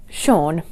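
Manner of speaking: Sean said as SHAWN; Sean, rhyming with lawn; correct pronunciation. Shown as a demonstration. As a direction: say Sean with SH and an AW vowel. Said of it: "it should be pronounced".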